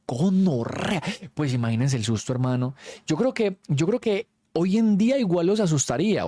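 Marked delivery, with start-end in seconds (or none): 0.1-1.0 slow, drawn-out frightened exclamation; scared cry. Retold or imitated. Kids hearing the ghost name them all, drawn-out scared cry. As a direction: let the fright stretch the word out. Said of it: "Y nosotros".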